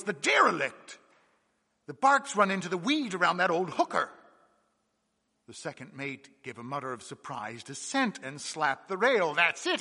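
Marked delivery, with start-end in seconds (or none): 0.0-4.1 sudden excited call, higher and louder; a young mate spotting the wreck, excited. A narrator's reading. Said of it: "with a little note of excitement in his voice". 9.0-9.8 excited. Acted. Mates agree over sighting.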